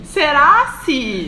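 high, loud, very slow mock-dramatic "Será se?"; hammed-up doubt. Friends reading fanfic aloud, hammy drawn-out "Será se". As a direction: stretch it out like a soap villain.

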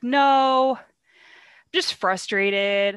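loud, drawn-out hammed-up "no"; mock denial. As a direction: stretch it out in mock protest. Brutus answers Cassius, acted.

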